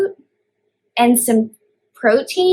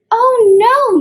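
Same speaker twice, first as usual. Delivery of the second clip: high, loud horrified gasp of oh no; shocked oh no; 11 semitones higher, 5 dB louder than usual. Acted. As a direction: shriek it like a scandalised mother goat. Mother Goat's horrified reply.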